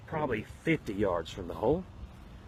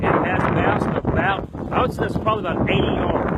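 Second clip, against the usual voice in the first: loud, higher mock announcer hype; hammed-up golf announcer shouting the play; excited commentary; 6 semitones higher, 10 dB louder than usual. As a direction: call the wild shot like a big-time commentator. Mock golf commentator hyping shot.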